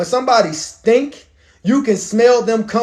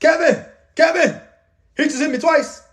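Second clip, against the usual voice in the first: high-pitched comic impression; Kevin Hart comedy bit; 8 semitones higher than usual. Comedian's bit about his dad, high comic astonishment. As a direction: call out in a high, amazed voice. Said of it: "you remember Kevin Hart's".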